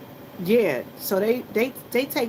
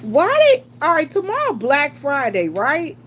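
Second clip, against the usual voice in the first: exasperated outburst; 4 semitones higher, 7 dB louder than usual. Real, not acted.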